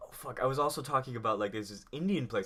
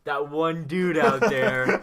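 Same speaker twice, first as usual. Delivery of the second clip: loud, slow, laughing call-out; loud laughing shout-out to a listener; 4 semitones higher, 10 dB louder, much slower than usual. Real, not acted. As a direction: call out to that one fan. Shout-out to a fan.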